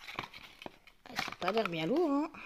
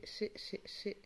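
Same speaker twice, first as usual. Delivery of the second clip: whisper; 8 dB quieter, slower than usual. Real, not acted. Hesitating, voice drops to whisper.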